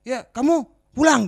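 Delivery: high, loud, drawn-out mock order; angry mother. Mother sending her child home, retold angry order. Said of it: "orang tuanya datang marah-marah".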